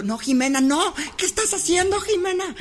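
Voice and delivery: high-pitched mock scolding. Friend in the car scolding Jimena, high and alarmed. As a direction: yelp it like a panicked friend.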